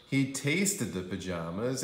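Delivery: plain reading voice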